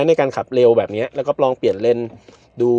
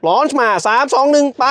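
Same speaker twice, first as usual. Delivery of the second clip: excited countdown; 12 semitones higher, slower than usual. Real, not acted. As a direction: call the countdown with rising thrill. Second launch countdown. (นับถอยหลังอย่างตื่นเต้น)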